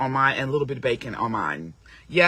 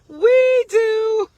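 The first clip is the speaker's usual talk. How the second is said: high, slow, sing-song tease; 7 dB louder than usual. Acted. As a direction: draw it out teasingly. Teasing sing-song repeat.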